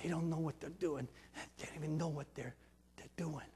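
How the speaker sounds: quiet, hushed forgiving words; soft prayer. Retold or imitated. Jesus on the cross praying for his killers, softly. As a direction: murmur it like a prayer. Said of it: "he's saying".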